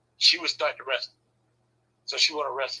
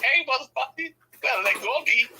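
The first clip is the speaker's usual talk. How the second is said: higher-pitched, alarmed order; mock urgent demand; 9 semitones higher than usual. Husband trapped in headlock tells wife off, mock urgent. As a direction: demand release in a raised voice.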